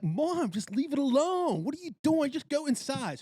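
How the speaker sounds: high, pleading and exasperated; urging mom. Retold or imitated. Telling her mother to leave the grasshopper alone, high and exasperated. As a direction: beg her to stop, voice way up.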